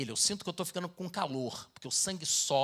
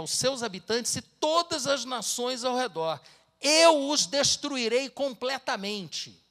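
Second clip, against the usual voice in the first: louder and higher, slowing to a heavy close; solemn scripture reading; 7 semitones higher, 6 dB louder than usual. Acted. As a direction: thunder out God's judgment. Reads scripture aloud.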